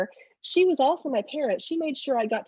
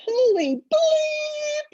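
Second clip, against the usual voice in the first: high, drawn-out, loud cry of shock; shocked exclamation; 5 dB louder, much slower than usual. Retold or imitated. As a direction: stretch it out, high and loud, like he can't believe it. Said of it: "he lets out these ex-, expletives".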